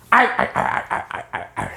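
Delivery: whispered arr arr chuckle; pirate laugh. Retold or imitated. Mr. Krabs laughing like a pirate, whispered arr arr.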